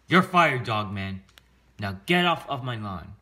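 loud, indignant authority figure; angry bossy mayor. Acted. Mayor firing the dog cop, angry and bossy. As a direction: fire them with loud, punchy orders.

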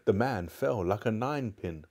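usual speaking voice

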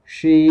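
"She" with lips pushed out, high and loud; pouted SHE. Shown as a demonstration. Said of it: "cái từ she và chu môi ra". ("She" chu môi, giọng cao và to)

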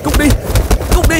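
loud panicked yell; a starving man screaming at hyenas to go; desperate shout. Acted. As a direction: drive the hyenas off, yelling.

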